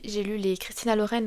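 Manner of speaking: ordinary delivery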